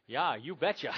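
mock Minnesota accent, loud. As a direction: put on a folksy Minnesota accent. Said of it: "I've lost my Minnesota accent".

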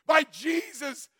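slow, loud preaching shout; preacher bellowing to the congregation, slow shout; shouted praise. Real, not acted. Preacher climaxing testimony.

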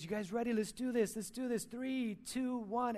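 put-on a touch of excited dad psyching up the kids; mock rallying dad. Dad rallies kids before scream.

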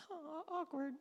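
small, high-pitched squirm; awkward reaction. Acted. Bystander reacting to Judas's outburst, awkward and squirming. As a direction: say it high and soft, cringing. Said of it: "You're just like".

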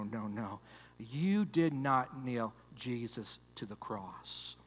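calm him down softly, like a pastor; gentle quiet reassurance. Retold or imitated. Pastor gently answers the brother.

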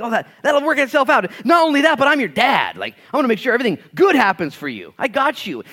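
put-on a dad promising his kids it'll be fine; reassuring dad. Dad reassuring his worried kids, high and loud.

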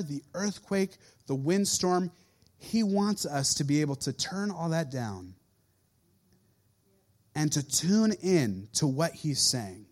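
ordinary talk, nothing marked

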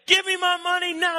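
high, loud demand for money; acted aggressive shout; angry demand. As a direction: play a furious creditor, loud and high. Angry servant demands repayment.

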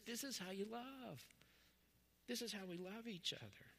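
soft, gentle teaching voice; lightly voiced tender Jesus; a hint of gentle instruction.

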